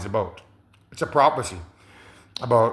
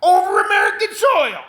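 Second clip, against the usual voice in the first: much higher and louder, an incredulous shout; shocked president; 5 dB louder than usual. Retold or imitated. President reacting to his adviser's nuke plan, shouting in disbelief. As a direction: cry out in disbelief at the idea. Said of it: "Then you hear the president say".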